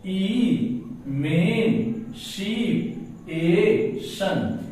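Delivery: "ई में शिप ए शन" slow, each syllable drawn out; teacher slowly sounding out "ई में शिप ए शन". Shown as a demonstration. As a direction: separate "ई", "में", "शिप", "ए", "शन" with short gaps. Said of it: "इसका आप syllable बनाते जाइए".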